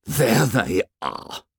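slow, bitter pointing-out. A narrator's reading. Boy spots those who left the fire, slow bitterness. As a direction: draw the words out bitterly. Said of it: "the bitterness of his voice".

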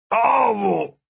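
talking through a mouthful; words round a bite of food; mouth full. A narrator's reading. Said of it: "said with his mouth full".

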